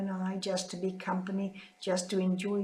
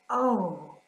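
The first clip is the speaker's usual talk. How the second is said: tearful whispered oh; 6 dB louder than usual. Real, not acted. Speaker moved remembering sister.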